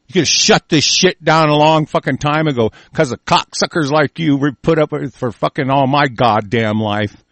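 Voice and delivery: ordinary talk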